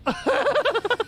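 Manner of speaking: high, slow hammed-up wail; slow high mock lament. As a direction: cry out for the puppies, melodramatic. Mock wailing over lost puppies.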